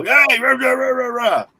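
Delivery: high, mock angry yelling man. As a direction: imitate a guy ranting at you, hammed up. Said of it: "Guy starts making a big old".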